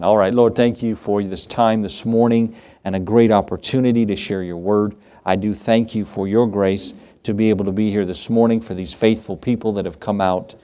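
earnest pastor's prayer to the Lord; preacher closing in prayer. Real, not acted. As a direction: pray it humbly to close the service.

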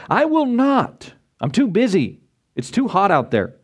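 defiant son. Acted. First son refusing his father.

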